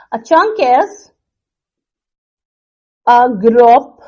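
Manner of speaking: slow phrases with very long gaps; dictating while writing. Real, not acted. Teacher writing the chunk definition for students, slow spaced-out dictation. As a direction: draw out each small group of words, then wait.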